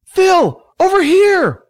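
high, loud shout across a crowd; calling out. A narrator's reading. Billy calls Phil over through the crowd, shouting. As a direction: holler to someone far away.

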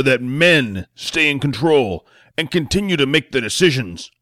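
a blustering senator playing to the cameras; pompous senator speech. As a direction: lecture the nation like a self-important politician. Senator giving his campaign speech.

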